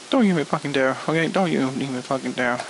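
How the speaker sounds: low, restrained threat; menacing warning. Pretend. Reviewer warns SpongeBob not to dare write it, low menace. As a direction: drop your pitch way down and warn them.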